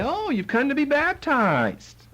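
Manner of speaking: high, slow, sarcastic sneer; mock sarcastic. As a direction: drawl it out with fake surprise. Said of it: "irritate them with his sarcasm".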